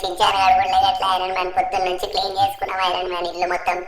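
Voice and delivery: whining, tearful plea with mock sobs; distraught Franklin. Franklin begs Iron Man to save Chinchan, tearful. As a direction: beg a friend desperately for help.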